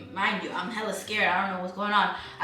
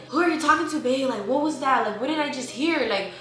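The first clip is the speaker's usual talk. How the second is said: higher-pitched, pressing questions; re-enacted alarmed interrogation; retold demanding questions; 5 semitones higher than usual. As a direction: confront him for an answer. Retold confronting boyfriend about whispering.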